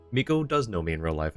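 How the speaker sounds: usual speaking voice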